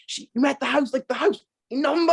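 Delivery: high-pitched incredulous dad; exasperated dad. Retold or imitated. Dad corrects child about house number, exasperated. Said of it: "he was, he was like".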